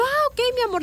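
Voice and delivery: high, soft surprised "ah, ok". Retold or imitated. Mother realizing she misread her son, softly surprised. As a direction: caught off guard, softly.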